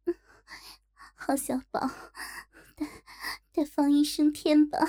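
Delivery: a hint of breathy coquettishness; coquettish. A narrator's reading. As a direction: coax him playfully. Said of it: "娇声说道".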